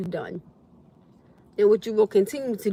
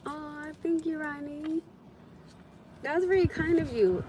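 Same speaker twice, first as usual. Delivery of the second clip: soft, higher "aw, thank you"; touched thanks; 5 semitones higher, 6 dB quieter than usual. Real, not acted. Streamer thanking a donor, softly touched. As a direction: melt at the gift.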